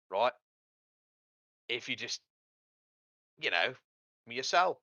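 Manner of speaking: put-on imp with a wobbly Australian accent; sly devil in a shaky Australian accent. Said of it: "Did the guy start off English and then end up Australian?".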